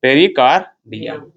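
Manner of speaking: "Pericardium" धीरे, टुकड़ों में; "पेरिकार्डियम" को "पेरि-कार-डियम" तोड़कर बोलें; शब्द दोहरवाने का लहजा. Shown as a demonstration. (कक्षा को "पेरिकार्डियम" धीरे रटवाएँ)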